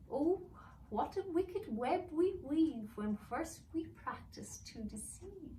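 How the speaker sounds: high, slow sing-song elf voice; a sly old elf quoting a proverb, drawn out. Acted. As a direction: elf reciting a saying, slowly. Elf character voice.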